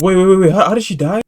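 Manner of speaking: high, loud repeated wait; urgent interruption. Real, not acted. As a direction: cut in to demand an answer. Urgent interruption demanding answer.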